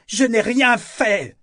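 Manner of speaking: high, loud, slow furious denial; violent outcry. A narrator's reading. Althea protests Kyle's accusations, crying out violently. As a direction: wail the protest at full force. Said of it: "s'exclama-t-elle violemment".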